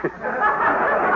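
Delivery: quick airy chuckle. Acted. Husband chuckling along with wife, quick airy laugh.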